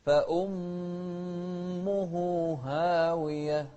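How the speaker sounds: فأمه very slow, sharp hamza. Shown as a demonstration. Teacher chants فأمه slowly for the student. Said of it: "شوف الهمزة".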